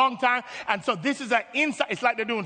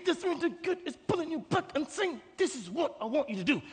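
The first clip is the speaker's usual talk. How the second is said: strained, softer acted voice, starting high; pulling you back; 6 semitones higher, 6 dB quieter than usual. The Spirit tugs you back to your call, softly straining. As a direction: squeeze it out softly as if tugging someone. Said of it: "the Spirit's gonna pull you back".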